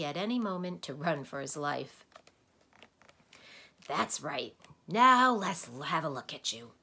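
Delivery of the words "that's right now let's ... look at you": loud sudden rasp, then inspecting; a raspy doctor examining his guests; croaking voice. Acted. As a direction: croak as you peer at them. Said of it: "strange croaking way".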